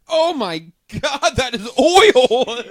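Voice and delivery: loud, high, drawn-out amazement; laughing shock. Real, not acted. Host seeing the thick beer pour, laughing shock. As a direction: exclaim it slowly through laughter.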